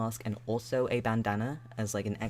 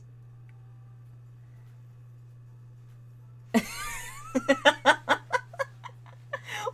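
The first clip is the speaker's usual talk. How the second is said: long high unvoiced laughter; high laughing fit; 4 dB louder than usual. Real, not acted. Cracks up at chat message.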